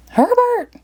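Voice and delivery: very high shout of a name; boy crying out for his goldfish; Billy cries. Acted. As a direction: call out for the goldfish. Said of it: "cried Billy McNilly".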